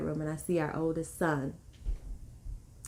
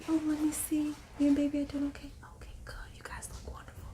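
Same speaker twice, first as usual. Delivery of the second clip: gentle midwife; 9 semitones higher, faster than usual. Retold or imitated. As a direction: imitate a hushed, kindly check-in. Midwife checking in on them.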